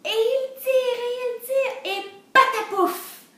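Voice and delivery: high, loud pulling chant ending in a burst; triumphant, full-voice storybook climax; straining climax. A narrator's reading. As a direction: heave hard, then let it tumble out.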